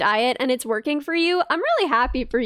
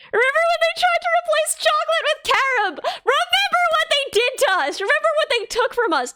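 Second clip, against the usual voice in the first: mock outrage; 11 semitones higher than usual. Mock lament over carob.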